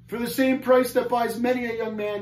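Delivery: high-pitched anguished moan. A narrator's reading. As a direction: moan it out in misery. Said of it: "he moaned".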